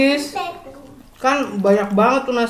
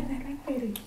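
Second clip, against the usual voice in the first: soft soothing; 2 semitones higher, 15 dB quieter than usual. Real, not acted. Soothing the child.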